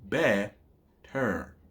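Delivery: better slowly split in two, bet, ter; split into syllables. Shown as a demonstration. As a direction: clear gap between bet and ter in better. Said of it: "two syllables in the word".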